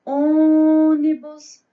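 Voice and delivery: loud, long-held "ô" at the start of "ônibus"; "ônibus" with a sustained, loud first syllable; vendor-style stressed syllable. Shown as a demonstration. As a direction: belt out and stretch the "ô" of "ônibus". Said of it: "Vamos vender essa palavra?".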